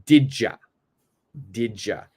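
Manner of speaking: "Didja" with d and y fused into a j sound. Shown as a demonstration. Teacher modelling "Didja" with a j sound. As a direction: say "Didja" as one quick word. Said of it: "becomes this word right here".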